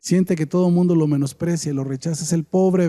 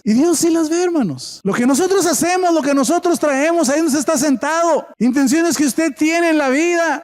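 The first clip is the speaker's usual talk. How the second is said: high-pitched, impassioned sermon delivery; preaching; 11 semitones higher, 4 dB louder than usual. Acted. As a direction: preach it out in a raised, urgent pitch. Preaches at full force. (predicando con fervor, voz aguda)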